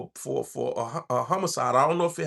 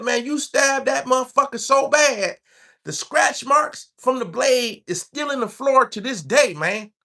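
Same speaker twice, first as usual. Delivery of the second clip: higher, mock country drawl reliving a stabbing; 10 semitones higher, 4 dB louder, slower than usual. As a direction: lightly play the gleeful inmate.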